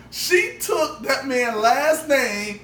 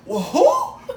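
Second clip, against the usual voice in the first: squeaked-up disbelieving who; mock baffled, pitch way up; 13 semitones higher, 4 dB louder than usual. Retold baffled reply.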